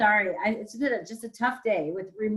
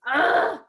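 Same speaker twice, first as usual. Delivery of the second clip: loud, exasperated groan; frustrated groan; 4 semitones higher, 4 dB louder than usual. Real, not acted. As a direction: let out real exasperation in one sound. Frustrated board member venting.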